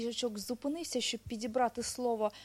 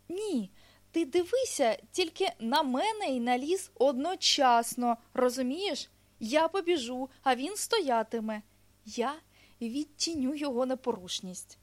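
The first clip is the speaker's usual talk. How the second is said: higher, louder, animated storybook hedgehog; hedgehog explaining eagerly; 3 semitones higher, 6 dB louder than usual. Acted. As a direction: explain it keenly as a little hedgehog.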